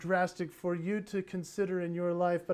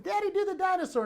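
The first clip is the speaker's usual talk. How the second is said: put-on much higher, eager child's plea; pleading kids; 10 semitones higher, 4 dB louder, faster than usual. Kids asking their daddy for a dinosaur, higher childlike plea. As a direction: beg like a small child.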